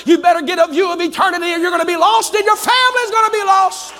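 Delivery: high-pitched, impassioned pulpit shout; full-force preaching. Real, not acted. Preacher warning people falling over the cliff, full-force preaching. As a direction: hammer each refrain home to the congregation.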